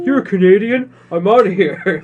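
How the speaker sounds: high, loud, put-on voice, laughing; Wilson voiced. Friends voice Wilson ditching a Canadian, laughing. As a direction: fake outrage as the volleyball. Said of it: "Wilson's just like".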